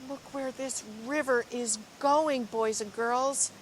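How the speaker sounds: lively, expressive storybook reading; reading to children. Acted. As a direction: read it like a picture book to a class. Teacher reads picture book aloud.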